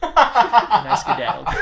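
laughing at his own getaway. Real, not acted. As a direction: spill it out mid-laugh. Pocketing money and fleeing.

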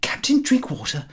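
sudden high cry of the name; a medium's high exclamation. Acted. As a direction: startle the room with a high call. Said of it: "startled us by crying out".

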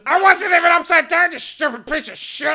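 very high, loud protest; full-volume acted fury; outraged shouting. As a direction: yell it back indignantly. Acted shouted quarrel in game.

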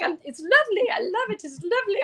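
high-pitched, gushing imitation of a friend; Jen. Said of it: "She's like".

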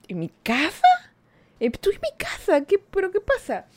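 hearing that he thinks she is out, and asking what's going on; baffled question. Retold or imitated. A woman baffled on the phone with her drunk ex, rising in disbelief.

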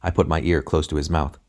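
usual speaking voice

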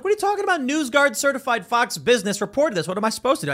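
protesting innocence; indignant retort. Retold or imitated. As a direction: argue back, mildly exasperated. Indignant retort to media accusations.

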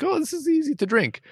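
high mock voice. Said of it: "where it's like".

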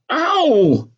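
high-pitched moan of pain. A narrator's reading. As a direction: cry out as the sore eye is touched. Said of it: "I moaned out loud".

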